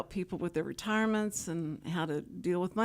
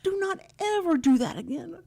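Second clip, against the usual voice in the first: stern grandson warning; 10 semitones higher, 4 dB louder than usual. Retold or imitated. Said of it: "he says".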